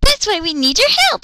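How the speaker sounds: put-on high, quick chirpy kid voice; high excitable child; little girl voice. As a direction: chatter like a hyper little girl. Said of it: "Yachiru said".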